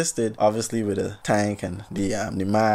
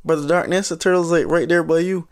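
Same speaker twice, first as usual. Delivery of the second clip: cheery tourist voice; 7 semitones higher than usual. Retold or imitated. Tourists telling Brother Darkness a turtle is near, chirpy high. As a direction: point it out brightly, oblivious.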